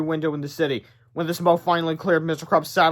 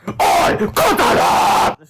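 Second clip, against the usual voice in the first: very slow, loud, higher scream; frustrated scream; 7 semitones higher, 9 dB louder, much slower than usual. Acted. Frustrated villain bitten, screaming. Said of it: "screamed the frustrated fiend".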